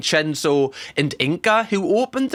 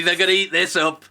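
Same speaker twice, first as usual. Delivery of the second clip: ordinary talk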